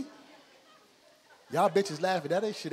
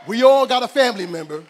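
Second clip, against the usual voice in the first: much louder, higher opener; loud build-up; 5 semitones higher, 13 dB louder than usual. Real, not acted. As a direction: belt the setup at the crowd. Punchline build-up.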